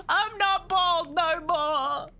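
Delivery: slow, drawn-out mock boast; playful brag. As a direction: stretch it out like a proud brag. Boasting hair grown back.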